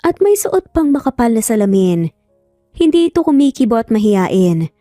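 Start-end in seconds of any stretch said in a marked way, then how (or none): none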